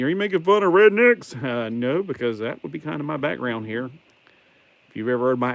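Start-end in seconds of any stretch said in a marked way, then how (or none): 0.0-1.4 high, loud mock-accusing question; a mock challenge from a viewer, high and loud; imagined viewer asking. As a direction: play the suspicious viewer calling him out. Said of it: "And you might be thinking".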